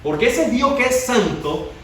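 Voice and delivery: rising, urgent sermon voice; fiery preaching. Acted. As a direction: preach it out to the whole church. Preacher builds to full force.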